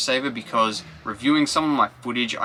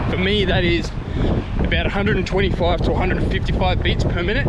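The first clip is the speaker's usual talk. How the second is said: out of breath; 6 semitones higher, 4 dB louder than usual. Real, not acted. Talking mid-run.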